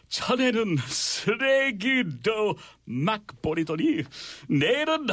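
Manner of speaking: put-on theatrical British accent, swooping high with long pauses. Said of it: "I'm gonna do a British accent".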